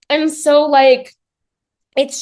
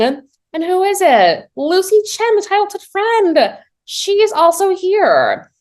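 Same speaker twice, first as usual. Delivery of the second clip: high, slow teasing reveal; dramatic reveal; 11 semitones higher, 3 dB louder, slower than usual. Real, not acted. Telling viewers who rings the bell, a high, slow teasing reveal. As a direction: draw out who's at the door.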